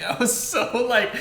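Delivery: ordinary talk, nothing marked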